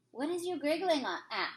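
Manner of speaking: slightly nettled. Acted. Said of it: "slightly nettled".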